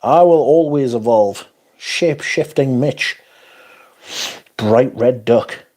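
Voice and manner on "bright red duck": ordinary talk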